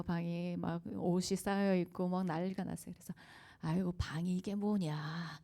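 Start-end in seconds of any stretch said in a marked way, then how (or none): 3.6-5.4 slow mild reproach. Retold or imitated. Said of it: "우아한 말투로 화내지 않고".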